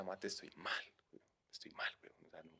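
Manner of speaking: hushed whisper trailing to almost nothing; retold whispered plea. Calling friend Mateo for help, whispered. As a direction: whisper it like a secret you're ashamed of.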